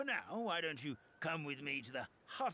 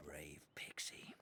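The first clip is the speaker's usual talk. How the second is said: whispered, slow blessing to the pixie; slow reverent whisper; hushed whisper; 10 semitones lower, 8 dB quieter, slower than usual. Acted. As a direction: breathe it out like a reverent old elder. Elder greets the pixie solemnly.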